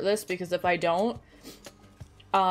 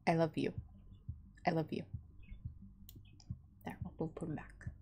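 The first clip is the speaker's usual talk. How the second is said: soft, tender I love you, much softer; 4 semitones lower, 16 dB quieter than usual. Real, not acted. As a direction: coo it to a beloved pet. Cooing to pet pug.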